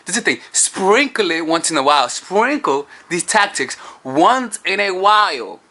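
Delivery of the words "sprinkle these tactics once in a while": drawn out slowly, each word weighted; slow emphasis. Real, not acted. Telling viewers the key rule, slow and weighted.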